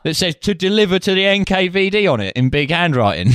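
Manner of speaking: mock pub landlord, laughing through the end; the landlord voice cracking into a laugh; British pub landlord. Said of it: "British pub landlord being like".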